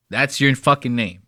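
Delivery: normal talking voice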